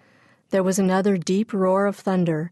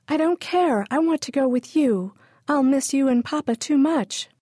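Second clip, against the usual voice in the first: put-on much higher pleading child voice; upset little girl; 8 semitones higher than usual. A narrator's reading. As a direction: beg not to be left behind. Girl protests being left behind.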